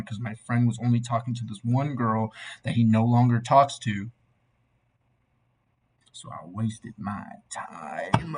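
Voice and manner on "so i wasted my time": slow breathy whisper to himself. Real, not acted. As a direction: whisper it under your breath, slowly. Self-pitying aside while reviewing.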